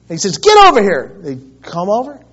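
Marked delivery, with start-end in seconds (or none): 0.4-1.2 loud, high command; retold yell. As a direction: call the kids over sharply. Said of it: "he yells at them".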